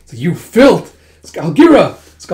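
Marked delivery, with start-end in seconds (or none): 0.2-1.0 loud, higher-pitched outburst; angry insult. Acted. Acted insult in fight. 1.4-2.0 loud, high cry of a name; urgent call. Acted. Acted call in burning palace.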